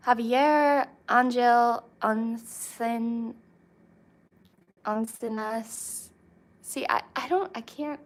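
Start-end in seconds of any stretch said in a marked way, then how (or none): 0.1-6.1 slow attempt at a Spanish accent. Shown as a demonstration. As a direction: sound out the name with a Spanish accent. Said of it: "I don't have a good Spanish accent".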